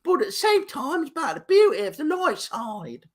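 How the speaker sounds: high-pitched Liverpool attempt that slips into Cockney; badly faked Liverpool accent, like a Monty Python falsetto; hammed-up Scouse-Cockney squawk, pitched very high. Shown as a demonstration. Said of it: "in your best Liverpool accent".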